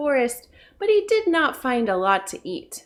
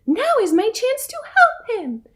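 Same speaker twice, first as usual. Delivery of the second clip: high eager mouse voice; tiny mouse, determined to help; 11 semitones higher than usual. A narrator's reading. Mouse hurrying to help lion, high and eager.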